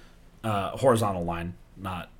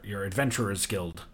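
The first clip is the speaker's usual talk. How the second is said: ordinary delivery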